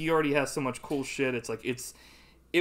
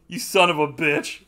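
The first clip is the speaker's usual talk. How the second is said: the same insult, louder and higher; outraged insult; 5 semitones higher, 9 dB louder than usual. Retold or imitated. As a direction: hurl it in disbelief. Own retold outraged reaction.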